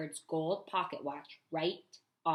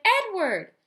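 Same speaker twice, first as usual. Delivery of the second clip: loud, high calling; 13 semitones higher, 9 dB louder than usual. A narrator's reading. Girl searching room to room: calling out. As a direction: shout his name searching. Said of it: "she shouted".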